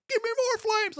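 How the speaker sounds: high-pitched imitation of an eager friend; mock excited yell. Bo eggs on the pyro.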